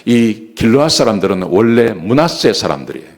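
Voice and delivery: normal talking voice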